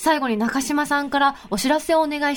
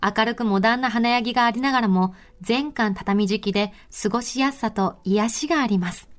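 sponsor ad read; 3 semitones lower than usual. Acted. Host reading a resort notice to listeners, measured ad read. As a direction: read the resort spot in unhurried phrases.

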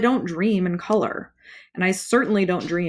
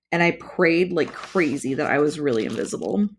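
ordinary delivery, nothing marked

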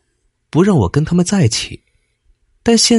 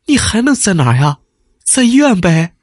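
complaining wife; 6 semitones higher than usual. A narrator's reading. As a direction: toss it back at him, annoyed. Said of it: "妻子抱怨着".

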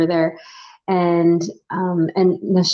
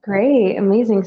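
usual speaking voice

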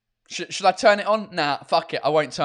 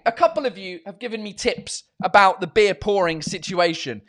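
usual speaking voice

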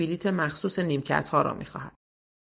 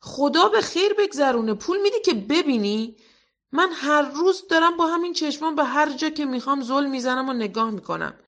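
put-on much higher, louder girl's outcry; exclaiming loudly; 9 semitones higher, 4 dB louder than usual. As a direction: cry out in mock shock. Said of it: "فریاد زد".

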